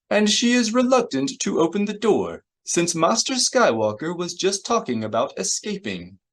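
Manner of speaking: very high, fussy droid voice; C three P O. A narrator's reading. Droid translating the guard's refusal, high fussy voice. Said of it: "C three P O said".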